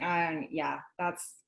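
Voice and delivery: low, soft, unimpressed mumble; annoyed. Retold or imitated. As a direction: imitate an annoyed husband trailing off. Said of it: "he just was annoyed".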